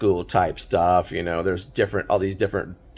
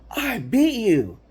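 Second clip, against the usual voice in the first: high, slow triumphant cry; overjoyed teen celebrating first win; elated shout; 13 semitones higher, slower than usual. Retold or imitated. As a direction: crow it like a kid who finally won. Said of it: "the elation on his face".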